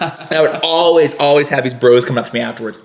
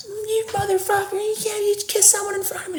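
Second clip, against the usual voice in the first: imitating angry girlfriend; 9 dB quieter than usual. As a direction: squeak out her fury in a pinched high voice. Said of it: "running out of the crowd screaming".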